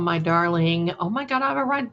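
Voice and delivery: usual speaking voice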